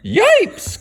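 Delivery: yelped way up high; a sharp high yelp of fright; frightened cry. Acted. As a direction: squeal it like scared kids. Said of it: "cried the kids".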